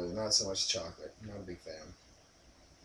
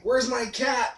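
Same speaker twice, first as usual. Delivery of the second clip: put-on girlfriend voice; 8 semitones higher, slower than usual. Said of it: "and, like".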